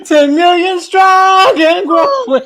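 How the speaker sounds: laughing. Pretend. Co-host slogan, laughing.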